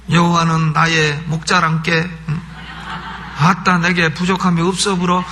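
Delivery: playful psalm reading in Jeolla dialect. Shown as a demonstration. Preacher showing Jeolla folk how to read a psalm, Jeolla dialect. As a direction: read scripture like a Jeolla local. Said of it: "전라도 사람은 전라도 버전으로 읽으세요".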